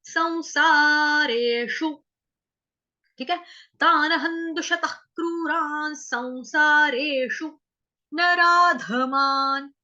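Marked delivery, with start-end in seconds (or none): 0.0-2.0 संसारेषु slow, with a clear nasal सं; nasal sam. Shown as a demonstration. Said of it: "तो उच्चारण होगा सं". 3.8-9.7 slow recitation. Shown as a demonstration. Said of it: "recites verse for students".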